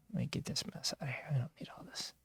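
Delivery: unvoiced whisper to yourself; whispered aside. Real, not acted. Host clearing clutter off his screen, whispered aside to himself. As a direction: mutter it under your breath while busy.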